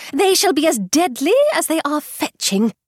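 high, proud exclaim; proud mother. A narrator's reading. Mother boasting of her daughters, high and proud. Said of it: "cried Missus Bennet".

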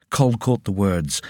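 ordinary delivery, nothing marked

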